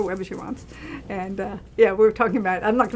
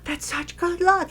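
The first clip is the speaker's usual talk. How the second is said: gasp, then slow, much higher, hushed wonder; awed gasp; 9 semitones higher, slower than usual. Retold or imitated. Seeing a butterfly land on the director, awed. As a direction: marvel at the little omen.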